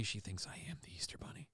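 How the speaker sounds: breathy secret whisper. Real, not acted. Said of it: "Why are you whispering?".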